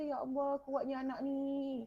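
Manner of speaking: very soft, hushed acted inner voice; quiet, awed thinking aloud; inner thought. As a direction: murmur it privately, as if only to yourself. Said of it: "Walaupun dalam hati kita".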